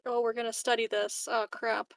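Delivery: ordinary talk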